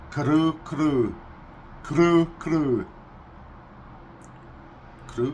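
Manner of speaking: grunting kru kru like a pig; pig grunt impression. Shown as a demonstration. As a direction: snort kru kru the Russian way. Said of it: "Russian is".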